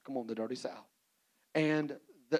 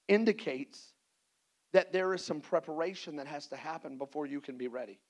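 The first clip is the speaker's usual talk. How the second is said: usual speaking voice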